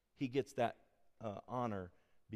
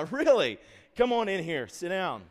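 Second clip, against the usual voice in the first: loud, higher summons, half-joking; dad calling kid in for questioning; mock stern dad; 8 semitones higher, 11 dB louder than usual. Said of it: "I'm like".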